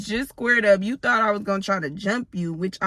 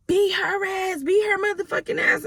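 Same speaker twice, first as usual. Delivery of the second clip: high-pitched mock yell, much higher; passerby yelling; 11 semitones higher than usual. Passerby yelling to egg on the fight. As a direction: hoot it out at a stranger's fight like a heckler. Stranger yelling at them in passing.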